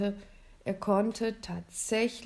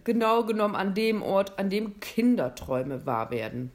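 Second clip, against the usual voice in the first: louder, hearty Santa Claus; lightly voiced Santa; 6 dB louder than usual. Santa telling William the North Pole grants dreams, louder and hearty. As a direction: boom it out a little, inviting.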